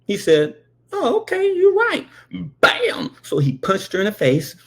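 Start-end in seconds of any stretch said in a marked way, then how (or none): 0.9-2.1 very high, exaggerated giving-in; falsely agreeable high voice; mock agreement. Dad mockingly agrees before punching. 2.6-3.2 sudden explosive "bam"; sharp mock hit; imitated punch sound.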